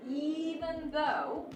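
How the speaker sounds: very slow "even though" with a rise on "though"; drawn-out even though. Shown as a demonstration. Teacher reading the worksheet sentence aloud, slow and rising. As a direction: stretch "even though" out slowly and lift it. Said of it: "the tone has to change".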